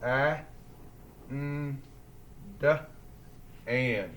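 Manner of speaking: "and" in separate sounds, short a, n, d, then blended; sounding out. Shown as a demonstration. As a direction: phonics drill on "and" with pauses. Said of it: "Roller coasters ready?".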